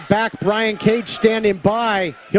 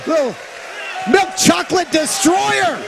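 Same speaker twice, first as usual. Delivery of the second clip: excited; 7 semitones higher than usual. Real, not acted. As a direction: yell the move name with glee. Calling the move name.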